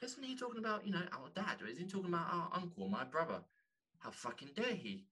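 high, indignant mock family voice; hammed-up outrage, pitched high; imitating outraged relatives. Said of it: "they'll be like".

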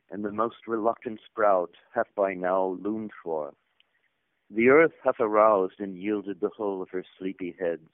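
grand, declaiming ritual reading; solemn scripture reading. Acted. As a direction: proclaim it like ancient scripture. Reads a solemn allegory aloud.

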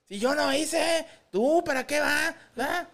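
high, loud mock-teen protest; exaggerated teen backtalk, way up high; adolescent voice change. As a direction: squawk like an indignant teenager. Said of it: "Les cambia la voz cuando es".

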